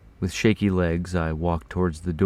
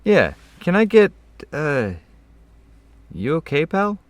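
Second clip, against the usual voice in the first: high-pitched male customer; casual request turning to a high, concerned question; customer's voice; 11 semitones higher, 7 dB louder than usual. A narrator's reading. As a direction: voice a customer much higher than the narration. Customer's dialogue in narrated story.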